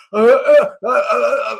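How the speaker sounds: high, loud hesitant uh sounds; mock stammer. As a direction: imitate a caught, flustered participant. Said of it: "And they're like".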